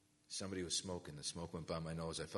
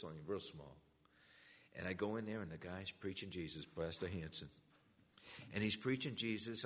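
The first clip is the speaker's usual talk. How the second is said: ordinary talk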